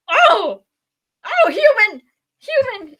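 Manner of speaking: high, loud exclamations of amazement; hammed-up astonished cries; awestruck shock. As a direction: re-enact stunned first sight of a newborn. Acting out first sight of baby.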